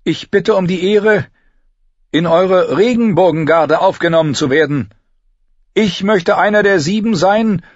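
earnest warrior woman. A narrator's reading. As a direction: beg the honour with fervour. Brienne voiced in the story.

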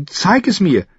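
put-on high, eager girl's demand; blurted out. A narrator's reading. Girl demanding to learn the trick, blurted eagerly. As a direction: burst out with it in a high voice. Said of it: "platzte sie heraus".

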